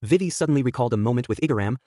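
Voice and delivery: usual speaking voice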